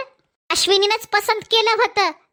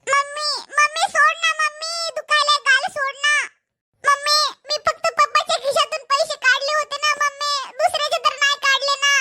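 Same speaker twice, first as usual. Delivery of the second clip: put-on child's voice begging mom to let go; a little boy whining to be released; pleading child; 4 semitones higher than usual. Child caught stealing, pleading.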